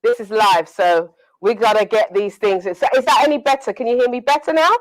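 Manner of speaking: normal talking voice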